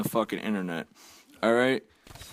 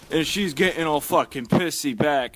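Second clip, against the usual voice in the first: usual speaking voice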